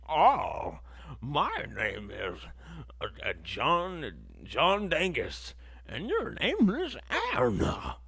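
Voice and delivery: slow, overdone leading-man voice, pitch jumping up on your; mock try-hard leading man. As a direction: ham up a stiff film hero introducing himself. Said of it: "he sounded like he was trying too hard to do his own voice".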